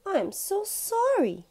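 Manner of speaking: pleading sorry. Acted. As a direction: beg forgiveness like a guilty child. Albie confesses.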